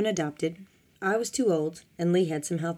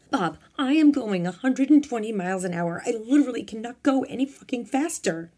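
raised, higher, protesting voice; exasperated shout back; 6 semitones higher, 4 dB louder than usual. A narrator's reading. Frightened assistant at the wheel finally shouting back at Bob. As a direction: protest loudly, finally snapping. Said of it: "finally shouted back".

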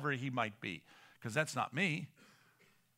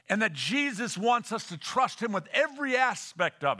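loud, higher, emphatic preacher; impassioned preaching; 8 semitones higher, 10 dB louder than usual. Acted. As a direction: drive each item home to the congregation. Preaches at full force.

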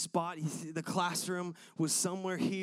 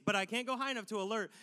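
ordinary talk, nothing marked

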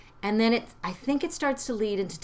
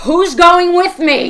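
high, loud, slow anguished plea; desperate cry in labor; 10 semitones higher, 17 dB louder, slower than usual. Shown as a demonstration. Mother showing Hermione's plea as labor begins, anguished cry. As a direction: cry out as the pains hit. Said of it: "She's going into labor".

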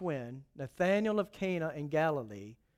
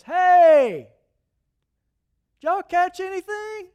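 loud, high call across the water; 11 semitones higher, 11 dB louder, slower than usual. Acted. Jesus calls from the shore to the tired fishermen, loud and high. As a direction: shout it out to a boat offshore.

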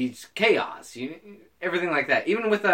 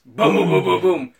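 loud, higher string of booms; mock explosions; 4 semitones higher, 7 dB louder, slower than usual. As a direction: boom it out like blasts going off. Imitating explosions in a quoted scene.

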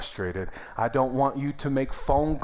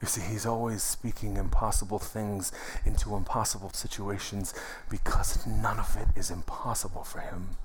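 ordinary talk, nothing marked

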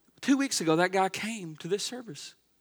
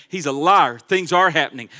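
ordinary delivery

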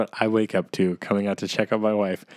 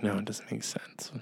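imitating her in a whisper; 12 dB quieter than usual. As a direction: breathe it out as a whisper. Wife's reply imitated in whisper.

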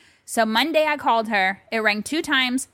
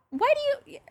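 exasperated disbelief; much faster than usual. Retold or imitated. Acted baffled reply to mom.

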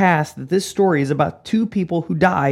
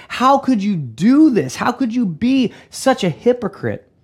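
loud, higher, heated rebuke; acted outrage at a hypocrite; going off on him; 6 semitones higher, 3 dB louder than usual. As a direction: hammer out the accusation. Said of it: "When he goes off on him".

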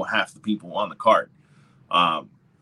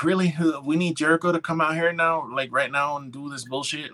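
mock disbelief; 8 semitones higher than usual. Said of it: "I was like".

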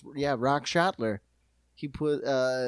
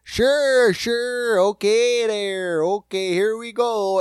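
louder, higher Chicago-guy voice; light Chicago accent impression; 4 semitones higher, 6 dB louder, slower than usual. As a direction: do the Chicago coach bit. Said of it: "just doing that voice again".

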